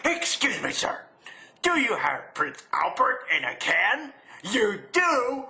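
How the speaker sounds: high, put-on prankster voice; prank caller. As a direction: build gleefully to a hammed-up punchline. Acts out old prank phone call.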